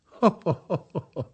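ho ho breaking into real laughter; laughing. A narrator's reading. Prince laughing at the girl's boast, with hearty laughter. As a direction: laugh it out, highly amused. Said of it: "powtarzał wciąż śmiejąc się Ramzes".

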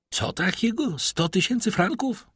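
high, astonished echo of the price; high-pitched, rising shocked query; incredulous question. A narrator's reading. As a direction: ask in disbelief, voice rising.

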